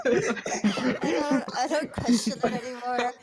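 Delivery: talking through laughter; laughing. Real, not acted. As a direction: laugh while saying it. Laughter after joke.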